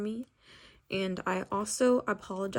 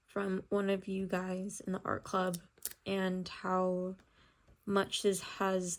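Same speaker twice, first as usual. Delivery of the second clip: ordinary talk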